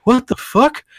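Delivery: the creep suddenly freaked out; mock horrified shriek-like question; shocked creep. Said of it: "they'd be like".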